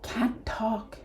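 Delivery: breathy voiceless whisper. Acted. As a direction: barely get the words out. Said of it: "the small orc just goes".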